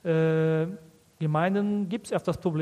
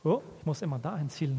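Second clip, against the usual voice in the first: ordinary delivery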